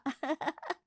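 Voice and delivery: short, high giggle; light giggle. Acted. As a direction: giggle lightly along with a friend. Office friends joke about earnings.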